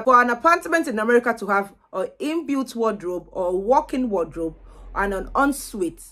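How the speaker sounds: ordinary talk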